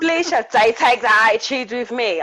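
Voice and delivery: put-on school-teacher reprimand; imitated teacher. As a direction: scold a pupil like an affronted teacher. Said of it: "saying to my teachers".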